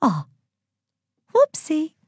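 high-pitched playful oops; mischievous. A narrator's reading. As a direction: feign innocence mischievously. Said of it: "said Aphrodite mischievously".

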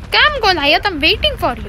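high, singsong taunt; teasing come-and-get-me call; playful beckoning. Pretend. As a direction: call the foe over in a high, lilting voice.

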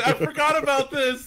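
loud, slow delighted yell; excited shout. Real, not acted. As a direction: shout it with glee. Rediscovers a game ability.

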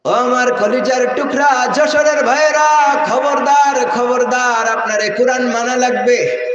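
forceful, drawn-out imitated preaching; another preacher's tune. Imitating a preacher warning the brothers of Jashore, slow forceful chant. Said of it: "দেখেন আপনারা কার সুর দিই".

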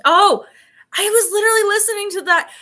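high, loud burst of surprise; excited realisation. Real, not acted. Streamer reacting to a chat message, loud excited realisation. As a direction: burst out loud as it suddenly clicks. Sudden recognition while reading chat.

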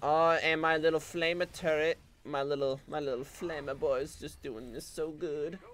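usual speaking voice

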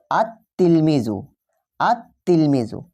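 slow আত তিলমিজু, the article's l replaced by a held t; silent lam, doubled t. Shown as a demonstration. Teacher models the article for students, slow doubled T in আত তিলমিজু. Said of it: "আত তিলমিজু কীভাবে আমরা উচ্চারণ করছি".

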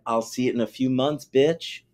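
ordinary talk, nothing marked

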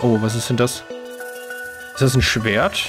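ordinary talk, nothing marked

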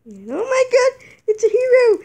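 high, loud, thrilled cheering; excited cheer. Acted. Toy character cheering at the hero, high and excited. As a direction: shout it out like spotting a hero.

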